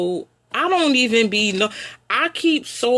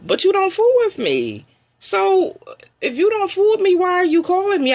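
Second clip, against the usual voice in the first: high, exasperated challenge; 9 semitones higher than usual. Real, not acted. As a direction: throw the question at them, fed up. Confronting family who ignore her.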